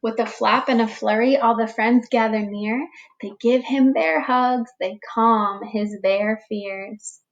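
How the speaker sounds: put-on slow, expressive read-aloud for young children; storybook reading. A narrator's reading. Teacher reading a picture book to the class, gentle storytelling. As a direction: read it like story time for little ones.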